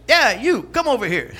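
lightly voiced parrot, insistent and high; talking parrot. Parrot answering the puzzled customer, insistently beckoning. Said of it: "this parrot over in the corner says".

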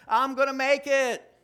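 loud, confident declaration; bold faith declaration. Shown as a demonstration. Preacher modeling what the congregation should say, loud and sure. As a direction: proclaim it like you mean it. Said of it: "why don't you just say that".

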